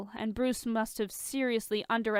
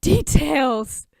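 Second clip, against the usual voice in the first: excited; 6 semitones higher, 10 dB louder than usual. Acted. Tony demanding gossip.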